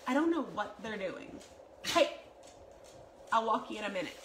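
ordinary talk, nothing marked

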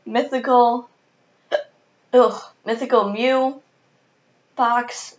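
hiccup cuts off the word; speaking through hiccups. Real, not acted. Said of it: "Please excuse my hiccups throughout this video".

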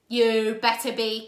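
loud, slow menacing villain; threatening villain. A narrator's reading. Count Olaf threatening the children, slow and loud. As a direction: draw it out as a threat.

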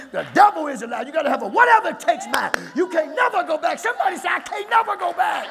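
climbing, high-pitched preacher's cry; full-force preaching. Real, not acted.

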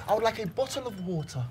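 soft, polite British English; quiet British accent, modelling it carefully; British model sentence. Shown as a demonstration. Showing the learner how to order, soft British accent. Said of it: "You gotta say".

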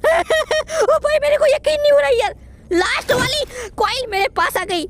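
high, loud disbelieving delight; thrilled. Real, not acted. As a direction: shout for joy at the last find. Finds last coil, thrilled.

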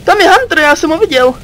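usual speaking voice

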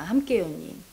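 함께해요님 breathed out in a low unvoiced whisper; soft whisper. Real, not acted. Counselor whispering 함께해요님 to the client, hushed. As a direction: whisper 함께해요님 gently, falling away. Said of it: "counselor addresses client softly".

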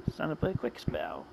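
very soft, low aside; hushed. Acted. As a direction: say it low and under your voice. Repeat of the line, much softer.

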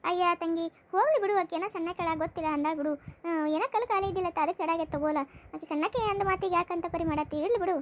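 usual speaking voice

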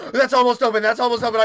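gasp, then high, urgent blurting; alarmed. Real, not acted. Player forgot about the fridge, alarmed. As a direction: blurt it out in sudden panic.

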